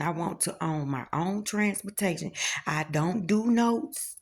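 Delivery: ordinary talk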